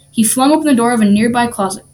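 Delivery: usual speaking voice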